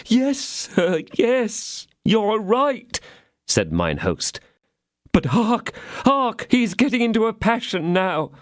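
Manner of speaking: a hint of a plaintive innkeeper, drawn out. A narrator's reading. Innkeeper voiced in story.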